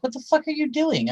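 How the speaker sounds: high, exasperated retold yell at him. Retelling to friends how she yells at Brian, exasperated. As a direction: snap at him like he's useless. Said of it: "and I'm like".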